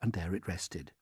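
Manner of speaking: usual speaking voice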